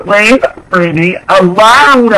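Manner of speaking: high, drawn-out mock wail; hammed-up high-pitched begging; dramatic plea. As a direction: plead slowly and melodramatically.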